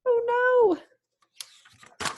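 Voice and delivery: high cry fading to a breathless whisper; alarmed oh no. Acted. Reader reacting as Zog's wing catches fire, a high alarmed then hushed oh no. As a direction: gasp at the fire.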